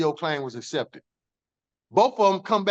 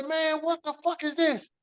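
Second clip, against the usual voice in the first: retold losing it; 8 semitones higher, 3 dB quieter than usual. Man retelling how he lost it over accusations, incredulous. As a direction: replay the disbelief with a raised pitch. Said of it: "I'm talking about I lost it".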